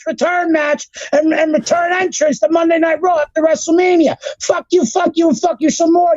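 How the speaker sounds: high, insistent cursing tirade; angry rant. Real, not acted. Podcaster cursing a producer for ruining a match, angry rant. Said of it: "The rant is real".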